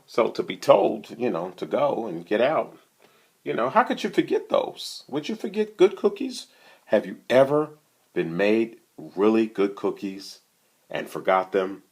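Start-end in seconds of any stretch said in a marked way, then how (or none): none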